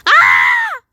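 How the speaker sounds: sudden high, loud scream. Real, not acted. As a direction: shriek out in shock. Startled scream in game.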